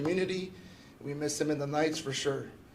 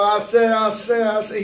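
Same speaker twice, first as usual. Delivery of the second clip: Foghorn Leghorn impression; 4 semitones higher, 9 dB louder than usual. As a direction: tease a friend with a hammed-up cartoon rooster voice. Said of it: "You're a Foghorn Leghorn".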